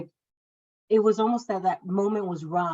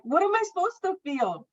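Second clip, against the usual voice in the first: much higher, exasperated question; incredulous retort; 12 semitones higher than usual. Retold or imitated. Mother repeating her retort to the midwife, high and exasperated. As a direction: ask it back in bitter disbelief.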